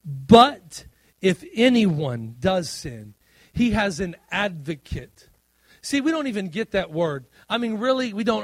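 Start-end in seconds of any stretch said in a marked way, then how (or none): 0.0-5.3 very loud, slow reading of the verse; slow scripture emphasis. Shown as a demonstration. Pastor reads First John aloud to the church, slow and loud. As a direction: lean hard into each word. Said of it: "It says this".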